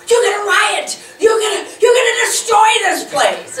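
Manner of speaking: high, loud alarmed yelling; mock panicked shout. A woman at the event screaming a warning. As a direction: shriek it like a frightened woman warning of riots. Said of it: "and she said".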